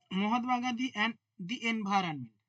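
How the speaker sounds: slow dictation. Shown as a demonstration. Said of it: "dictating a book title slowly".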